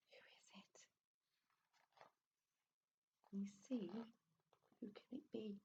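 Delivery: hushed, secretive whisper; whispering to the listening children. A narrator's reading. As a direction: lean in and whisper the question.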